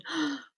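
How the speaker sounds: sharp high gasp of alarm; a quick theatrical gasp of horror; mock gasp. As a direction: gasp as if caught out. Self-judging mum panics.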